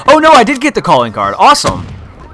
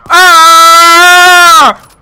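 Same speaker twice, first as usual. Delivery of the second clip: loud, high, held scream; drawn-out yell in the heat of the game; long scream; 9 semitones higher, 7 dB louder than usual. Real, not acted. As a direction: scream it out at full voice. Long scream during firefight.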